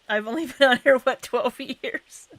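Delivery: loud, laughing joke. Real, not acted. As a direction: crack up while saying it. Joking about years on the platform.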